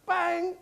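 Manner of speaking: high, punchy "bang"; imitated bat strike. Horse hits the balls in the nets, punchy bang. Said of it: "the horse went".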